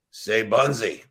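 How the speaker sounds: a light take on Fred's odd way of talking; Fred talk funny. Retold or imitated. To Bunzy in the weight room, a mild Fred-style drawl. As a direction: lightly mimic Fred calling out to his teammate. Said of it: "then you get Fred talk funny".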